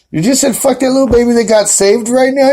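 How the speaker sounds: mock outrage at the words; shocked disbelief. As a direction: ask it in baffled alarm. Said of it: "I'm like".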